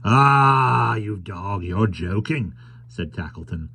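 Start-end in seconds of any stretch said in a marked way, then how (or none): 0.0-2.5 drawn-out opening cry, then easing off; lightly voiced sly trader. Tackleton ribbing the carrier, mock accusing. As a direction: tease a friend as a jovial schemer.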